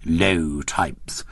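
put-on low, scornful drunken mumble. A narrator's reading. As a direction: sneer it out, half-drunk. Drunk Etonian muttering.

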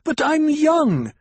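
high, loud, drawn-out cry of indignation; foot-stamping high-pitched outburst; angry protest. A narrator's reading. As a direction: wail it slowly in outraged protest. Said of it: "shook her shoulders with anger".

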